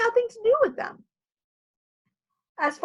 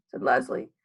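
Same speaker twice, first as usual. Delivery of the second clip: ordinary delivery, nothing marked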